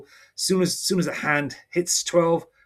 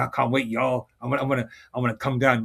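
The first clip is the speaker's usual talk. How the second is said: ordinary talk, nothing marked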